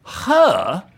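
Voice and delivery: high, loud disbelieving "Her?"; incredulous. Acted. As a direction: scoff at the very idea. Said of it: "Don't say it like that".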